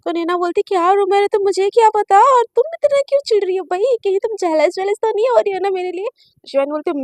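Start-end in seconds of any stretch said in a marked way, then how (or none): 0.7-6.1 higher, louder, rapid-fire comeback; exasperated retort. A narrator's reading. Naina asks if Shivani is jealous, exasperated and challenging. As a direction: fire back quickly, challenging her.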